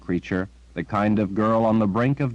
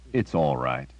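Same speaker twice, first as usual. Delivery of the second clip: reassuring; 6 semitones lower than usual. A narrator's reading. Comforting a terrified girl.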